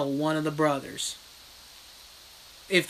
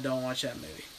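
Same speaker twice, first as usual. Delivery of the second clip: quiet plea; 4 semitones lower, 8 dB quieter, faster than usual. Shown as a demonstration. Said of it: "Please, for the love of God".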